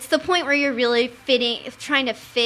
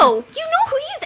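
much higher, louder, fast squeal; delighted surprise; 7 dB louder, faster than usual. Retold or imitated. A teen thrilled her mom knows Anthony, much higher and fast. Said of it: "I'd be like".

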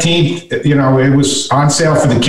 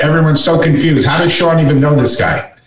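normal talking voice

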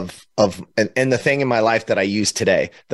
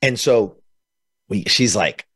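ordinary talk

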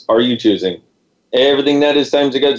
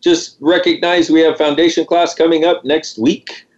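usual speaking voice

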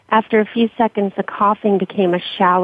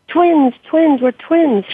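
high, loud, fervent repeats; excited calling out; 8 semitones higher, 3 dB louder, much slower than usual. Acted. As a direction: call it out with delirious joy. Dying friend calls out.

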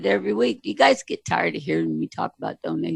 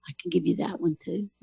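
normal talking voice

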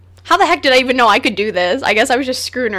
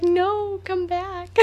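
high, softer mock plea; imagined pleading listeners; 8 semitones higher, 5 dB quieter, much slower than usual. Host voicing listeners at show's end, mock pleading. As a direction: beg playfully like fans losing their show. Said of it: "You're probably like".

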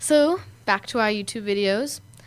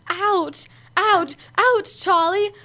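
high, rising mock cries of pain; imitating a bitten little boy, pitch climbing; whining kid; 9 semitones higher, 3 dB louder, much slower than usual. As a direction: whine ouch like a little boy whose finger is bitten.

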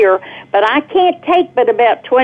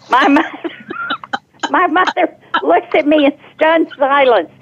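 laughing, pitched up, telling the punchline; laughing through it; 7 semitones higher than usual. Real, not acted. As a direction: let a laugh break into the words.

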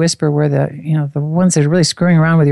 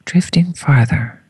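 whisper; slower than usual. Acted. Whispered during countdown.

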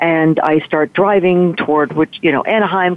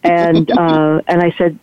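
ordinary talk

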